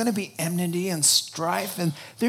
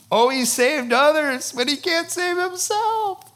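much higher, sneering taunt; mocking sneer; 11 semitones higher, 4 dB louder than usual. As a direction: sneer it up high in scorn. Jeering crowd at the cross.

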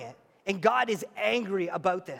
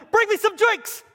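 very high, loud first demand; shrill it out, spoiled; mock demanding wife; 11 semitones higher, 6 dB louder than usual. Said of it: "you say to your husband".

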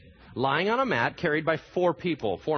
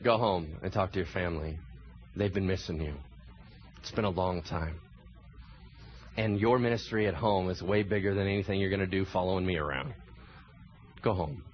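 much lower, much softer, tender send-off; gentle Jesus; 9 semitones lower, 6 dB quieter than usual. Acted. Jesus telling the healed man to go home, softly tender. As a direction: speak it softly like a kind parting blessing.

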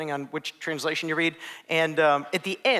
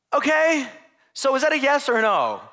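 baffled; 8 semitones higher, 5 dB louder than usual. Acted. Confused recruit reacting.

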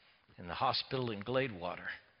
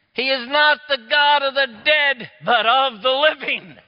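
much higher and louder, proclaiming; fervent preaching; 12 semitones higher, 14 dB louder than usual. Acted. Preacher proclaims the living God to the congregation, fervent preaching. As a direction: proclaim it at full force.

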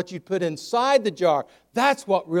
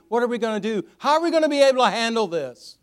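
raised, loud, anxious questions climbing higher; acted panic; 7 semitones higher, 7 dB louder than usual. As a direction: voice a crowd's rising panic. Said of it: "everybody else is in a state of panic".

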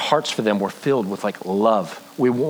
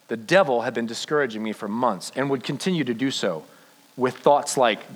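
ordinary talk

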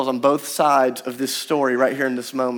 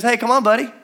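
friendly call; 6 semitones higher, 3 dB louder than usual. Acted.

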